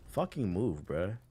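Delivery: ordinary delivery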